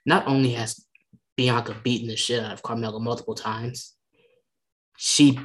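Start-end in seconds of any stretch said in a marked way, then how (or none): none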